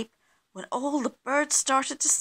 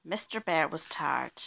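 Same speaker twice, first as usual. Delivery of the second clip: ordinary delivery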